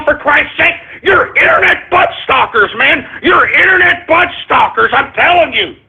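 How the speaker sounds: high-pitched, exasperated outburst; angry rant. Real, not acted. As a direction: lay into the prank callers, pitch climbing. Host rants at prank callers.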